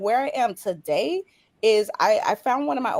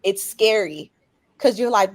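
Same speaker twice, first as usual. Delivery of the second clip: normal talking voice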